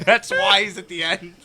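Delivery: laughing. Real, not acted. Laughing repeat of Trump quote.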